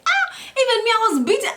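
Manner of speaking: a high, mock-whiny friend complaining; friend's voice. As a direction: pitch it way up as the beaten friend. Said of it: "Then they're like".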